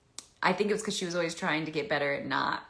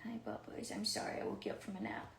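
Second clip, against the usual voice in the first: hushed, unvoiced apology to the pet; soft whisper to dog; 6 semitones lower, 9 dB quieter than usual. Real, not acted. Apologizing to her sleepy dog Bubbles in a whisper. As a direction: tenderly whisper sorry.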